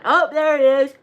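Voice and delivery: words stretched by a real yawn. Real, not acted. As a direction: talk through a big yawn. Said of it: "I thought I was gonna yawn".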